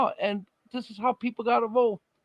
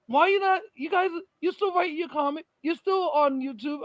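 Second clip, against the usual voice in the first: quoting him; 6 semitones higher than usual. Retold or imitated. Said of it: "And he's like".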